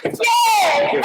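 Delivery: loud, happy cheer; cheering yay. Acted. Little red-haired girl cheering that everything worked out, loud and happy. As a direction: shout it out in delight.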